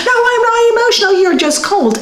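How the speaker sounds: high, fast, indignant denial; defensive retort. Retold or imitated. Wife retorting to her husband in a quarrel, fast and high-pitched. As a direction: snap back as if wounded.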